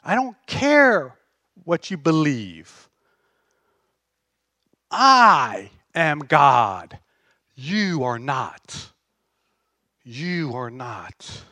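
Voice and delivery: slow, weighty divine declaration; voice of God. Acted. Preacher speaking as God to doubters, slow and stern. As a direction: lay down sovereignty word by word. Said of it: "and saying".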